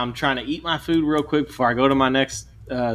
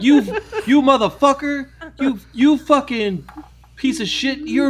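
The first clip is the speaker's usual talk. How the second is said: imitated high-pitched yelling, words drawn out; mock a man cussing someone out; slow, halting high holler; 12 semitones higher than usual. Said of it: "starts yelling at this dude".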